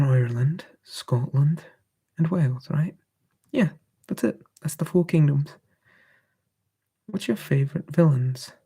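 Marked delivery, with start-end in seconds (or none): none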